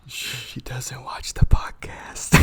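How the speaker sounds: slow laughing whisper. Real, not acted. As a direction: whisper it secretly, then giggle. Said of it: "I love how you're whispering".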